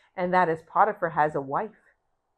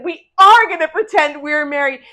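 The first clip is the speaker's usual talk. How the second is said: higher, louder insistence; forceful demand; 8 semitones higher, 15 dB louder than usual. Acted. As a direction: order him, loud and high. Wife grabbing Joseph.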